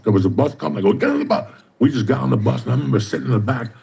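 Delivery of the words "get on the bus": high, urgent push onto the bus; urgent order. Retold or imitated. Fleeing the robbery, he urges Kathy onto the bus, high and urgent. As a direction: hurry her along, pitched way up.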